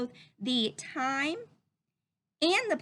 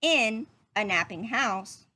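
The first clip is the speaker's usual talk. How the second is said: put-on reading to children. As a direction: read it gently to little listeners. Reading picture book to children.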